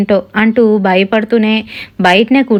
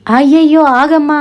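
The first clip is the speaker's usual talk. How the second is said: alarmed cry; 6 semitones higher than usual. Acted. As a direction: cry out in alarm to stop her. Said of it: "గట్టిగానైతే".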